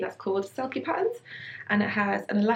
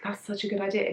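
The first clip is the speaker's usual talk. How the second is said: whispered quote. Retold or imitated. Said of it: "I was like".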